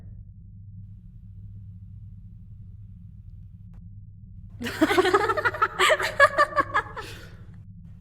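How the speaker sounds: long breathy high mocking laugh; gloating unvoiced cackle. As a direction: laugh at the fooled assassin. Vampire mocks captured assassin.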